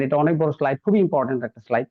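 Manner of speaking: normal talking voice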